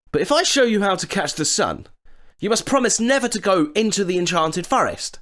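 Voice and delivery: put-on high boyish voice; boy's voice. A narrator's reading. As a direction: sound cheerful and clever as a boy. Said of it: "said Jack".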